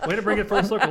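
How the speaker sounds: high voice through laughter; laughing. Real, not acted. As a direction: talk while cracking up. Laughing fit continues.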